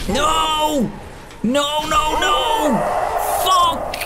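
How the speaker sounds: frantic cries of no as enemies swarm; panicked yelling. Real, not acted. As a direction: scream it in real panic. Panicked yelling at attacking hedgehogs.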